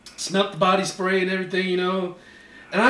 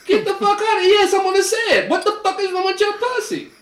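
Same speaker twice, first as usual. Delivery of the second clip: high-pitched, outraged demand; indignant outburst; 10 semitones higher, faster than usual. Acted. Man telling the woman off in his story, indignant outburst. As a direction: let loose with high, exasperated indignation.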